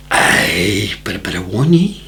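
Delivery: sly Scottish warning, saved for the punchline. Retold or imitated. Scotsman hints at hanky-panky, deadpan Scottish accent. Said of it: "beautiful Scottish accent".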